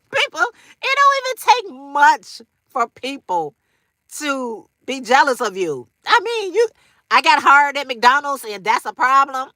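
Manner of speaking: laughing. Real, not acted. Laughing through jealousy remark.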